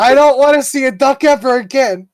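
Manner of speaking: high, loud re-enacted wail of terror; screaming retold outburst. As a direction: act out a child's screaming panic. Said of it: "I'm screaming and crying".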